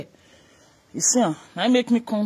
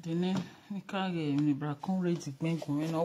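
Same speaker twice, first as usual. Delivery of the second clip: very quiet; 4 semitones lower, 14 dB quieter than usual. Real, not acted. Very quiet speech.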